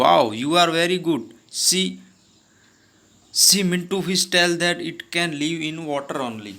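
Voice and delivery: slow, clear read-aloud of the story dialogue. Acted. Teacher reading the story's English lines to her class, slow and deliberate. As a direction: read each English word slowly for the class.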